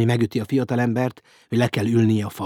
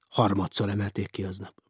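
plain reading voice

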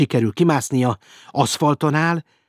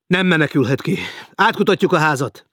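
loud, raised, commanding voice; officer calling out to his men; shouted order; 7 semitones higher, 6 dB louder than usual. A narrator's reading. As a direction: shout orders to start a search. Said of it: "kiáltotta Elder".

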